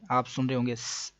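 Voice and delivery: ordinary delivery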